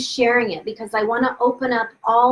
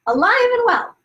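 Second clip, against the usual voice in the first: "Alive and well" pitched far higher, stretched in disbelief; incredulous repeat; 12 semitones higher, 4 dB louder than usual. Real, not acted. Telling viewers the ex's mom lived, incredulous. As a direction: echo it in high disbelief.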